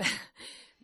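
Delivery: quick embarrassed airy laugh; breathy laugh. Real, not acted. Author laughing about writing at night, airy laugh. Laughing over husband anecdote.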